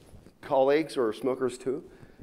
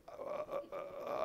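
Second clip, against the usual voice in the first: barely voiced, halting mock stammer; fearful stammer; 4 semitones higher, 20 dB quieter, much slower than usual. Smoker balks at phoning his daughters, fearful stammer. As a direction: imitate a man too scared to get words out. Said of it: "You should have saw the fear in his eye".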